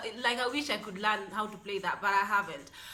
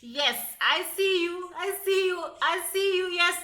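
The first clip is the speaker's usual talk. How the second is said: higher, playful I see you; excited shout-out; 6 semitones higher, 4 dB louder than usual. Real, not acted. Greeting American viewers, an excited higher shout-out. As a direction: point at the viewers with glee.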